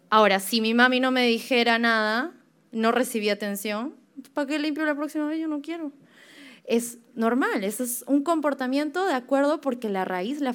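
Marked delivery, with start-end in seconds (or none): 4.3-5.9 put-on fast, higher whiny kid refusing; sulky child. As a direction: pout it out like a kid who got no praise. Child sulking about not cleaning. (niño berrinchudo, rápido y más agudo)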